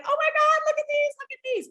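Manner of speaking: high, fast, loud excited squealing. Retold or imitated. Friends unboxing shoes at the hotel, squealing fast. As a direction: gush over the new box of shoes. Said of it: "we're screaming".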